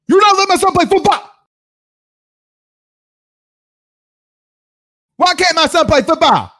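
high, fast mocking whine of an upset parent; mock whiny parent. Host mocking a parent whining about his son, high and fast.

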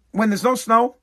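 usual speaking voice

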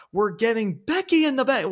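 high, loud put-on commentator voice; mocking his Money in the Bank call, high and loud; Kevin Patrick impression. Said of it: "I can't do his accent".

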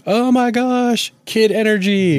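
high, drawn-out dog voice; imitated pet thrilled by a kid; excited dog. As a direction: squeal it slowly as an overjoyed pet. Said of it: "sees Owen freaking out sometimes and is like".

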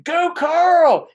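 high, loud cheering shout; yelling. Retold or imitated. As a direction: shout it across the room like a fan. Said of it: "I'm yelling".

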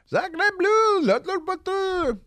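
very high hammed-up French cry; Pepe Le Pew. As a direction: squeal it like a cartoon Frenchman. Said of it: "turned into Pepe Le Pew".

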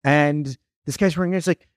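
usual speaking voice

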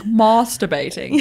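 slow "masturbating" with a long ah first; mock-fancy "masturbating", first vowel as in father; fancy long-a pronunciation. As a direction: draw out "masturbating", starting like "mahs". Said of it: "I feel like it's so fancy".

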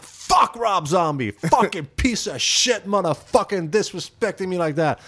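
loud, high, hammed-up tirade; fuming rant. Singer on the bus venting about [name], fuming. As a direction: blow up in retold outrage at the disrespect. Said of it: "I started fuming".

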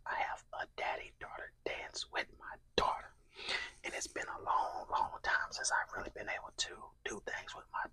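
whispering. Real, not acted. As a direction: whisper close to the mic while eating. ASMR mukbang whispered throughout.

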